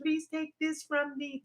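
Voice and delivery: hushed imitation of a plea on the mountaintop; mock plea. Speaker imitating Jesus praying on the mountaintop, softly pleading. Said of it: "on the mountaintop talking about".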